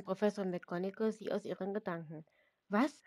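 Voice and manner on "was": a sharp, startled "Was?". Acted. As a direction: jolt out of a daydream. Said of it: "schreckte Lucy hoch".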